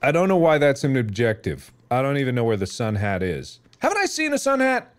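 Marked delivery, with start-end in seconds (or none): 3.8-4.9 high, pitched-up wondering; high questioning tone; puzzled searching. Pretend. Searching aloud for the sun hat.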